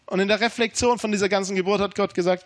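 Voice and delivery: ordinary delivery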